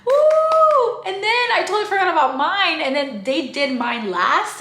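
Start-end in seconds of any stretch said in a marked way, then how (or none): 0.1-1.0 very high, loud woo; cheer. Retold or imitated. Friends cheering at the fake birthday. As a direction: whoop it up. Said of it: "we're like".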